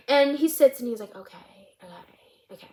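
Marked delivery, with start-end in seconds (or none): 1.1-2.7 tiny whispered okays, fading away; jittery okays. Retold or imitated. Jittery counselor taking in the answer, whispering to himself. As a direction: let each okay shrink to a whisper. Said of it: "the counselor is quite jittery".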